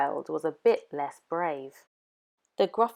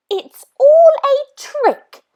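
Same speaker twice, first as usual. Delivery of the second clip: very high, loud and slow, scoffing; a little monster calling out a trick; Gruffalo's child; 13 dB louder, slower than usual. Acted. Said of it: "said the Gruffalo's child".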